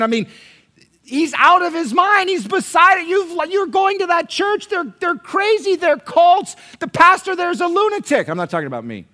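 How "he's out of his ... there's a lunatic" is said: high, loud, hammed-up family alarm; frantic mock accusations at full volume; outraged relatives. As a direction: shout like a scandalized relative staging an intervention. Family's outraged intervention acted out.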